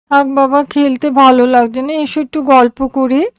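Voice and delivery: put-on high, tired girl's voice; a sick child giving up the game; weary daughter. A narrator's reading. Daughter asking father to talk instead, high and weary.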